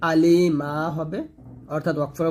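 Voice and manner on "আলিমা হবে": আলিমা stretched very slowly with a long final a; slow chanted আলিমা; recitation demo. Shown as a demonstration. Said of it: "ওয়াকফ ধরি".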